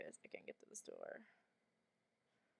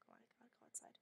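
panicked whisper; 22 dB quieter, faster than usual. Real, not acted. Hushed panic while hiding.

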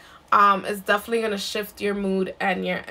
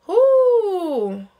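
loud whoo; 10 semitones higher, 6 dB louder than usual. Real, not acted. Shaking off heavy energy.

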